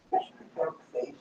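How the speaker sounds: soft whisper, teasing the dog; whispered pet talk. Real, not acted. Owner whispering a pet nickname to his dog.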